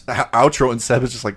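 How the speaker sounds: normal talking voice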